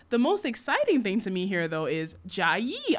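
normal talking voice

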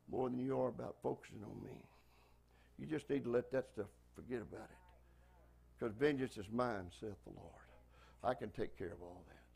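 low, hushed voice of God, retold; quiet divine reassurance. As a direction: drop low and soft, as God settling a worry. Voicing God's reassurance to him.

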